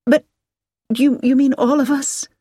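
high, halting disbelief; taken aback, pitch jumping up; surprised. A narrator's reading. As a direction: stammer out a startled question. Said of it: "Hilary said with surprise".

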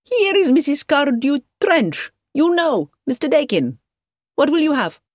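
high-pitched, effusive host; effusive hotelier Marcus. A narrator's reading. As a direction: greet a guest and offer drinks in a high voice. Hotelier greets another guest.

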